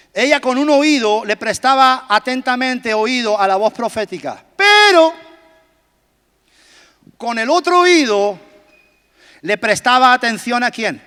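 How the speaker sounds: ordinary delivery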